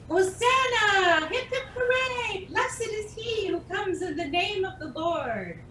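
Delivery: joyful crowd cheering; excited crowd cheer. Acted. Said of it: "people went ahead of Jesus shouting".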